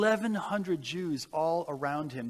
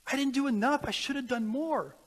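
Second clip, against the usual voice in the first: high, louder voice of growing distress; anguished lament; 8 semitones higher, 3 dB louder than usual. Retold or imitated. Oskar laments to the people he saved, anguished and raised. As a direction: let the regret pour out, pitch climbing. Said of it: "He goes".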